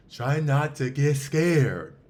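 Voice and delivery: spooky announcer. Pretend. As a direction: drag it out like a scary movie title. Dramatic spooky title announcement.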